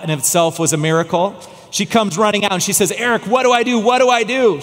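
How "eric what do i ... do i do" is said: a touch of her panic, pitched higher; lightly voiced frightened wife; panicked wife. Wife panicking over her trapped husband, mild fear. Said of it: "She was full of panic and fear".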